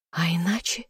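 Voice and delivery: soft teasing whisper, rising at the end. A narrator's reading. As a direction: breathe it against his lips. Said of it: "прошептала ему в губы".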